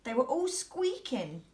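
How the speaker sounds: usual speaking voice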